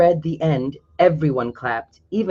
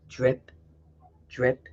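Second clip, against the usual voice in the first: lower, much softer drip drip; soft dripping; 5 semitones lower, 8 dB quieter than usual. Acted. Narrator marks drops leaving Felix's bucket, softly. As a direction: let each drip fall quietly.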